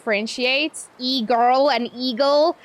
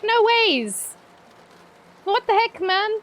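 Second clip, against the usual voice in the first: shocked disbelief; 9 semitones higher, slower than usual. Retold or imitated. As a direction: gasp it out in disbelief. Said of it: "I was like".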